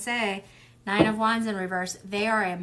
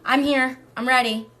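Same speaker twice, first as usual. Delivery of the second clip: eager announcement; 4 semitones higher, 8 dB louder than usual. Retold or imitated. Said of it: "she's like".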